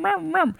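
high mock retching sound; dog retching noise. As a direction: imitate a dog about to throw up.

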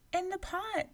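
put-on high small child's voice; little girl. A narrator's reading. Girl answering the giant, high and small.

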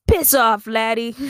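high, loud mock-Irish retort; bad Irish accent. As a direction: tell someone off as a stage Irishman. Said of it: "the Irish lad guy said".

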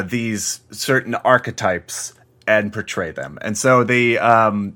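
normal talking voice

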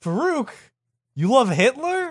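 very high, incredulous squeak; hammed-up disbelief; mock shock. Acted shock at Farouk's letter.

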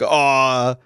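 put-on dopey dog-lover voice; gushing dog owner. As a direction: do a mocking dog-owner impression. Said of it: "that's what dog owners all sound like".

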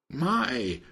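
a drawn-in storybook "my"; mild exclamation. A narrator's reading. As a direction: exclaim without raising volume.

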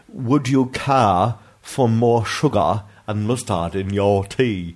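slow, drawn-out bad British accent; awful British accent. Reading out a listener's misspelt tea question, awful British accent. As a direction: overdo the British vowels, dragging each word. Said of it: "that was an awful British accent".

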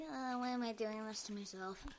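whispered aside to herself; whispering. Retold or imitated. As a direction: breathe it out as a whisper. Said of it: "I'm like".

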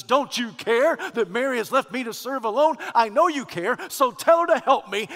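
high, loud grievance; indignant complaint. Acted. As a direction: demand help like an overworked sister. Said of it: "Martha had just blasted Jesus".

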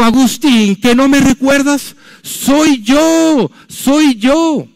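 high, loud repeated calling; insistent calling. Retold or imitated. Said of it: "la mujer siguió gritando". (la mujer sigue gritando, aguda y fuerte)

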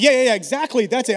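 loud, high, overeager yes; hammed-up eager yes-man; eager fake agreement. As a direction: cover yourself with a quick loud agreement. Fake agreement to dodge evangelising.

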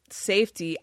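normal talking voice

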